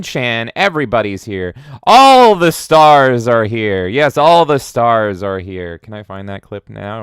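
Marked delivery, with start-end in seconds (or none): 1.8-5.8 slow, loud proclamation; loud slow mock announcer; grand announcement. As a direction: announce like a red carpet host. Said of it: "announces stars in chat".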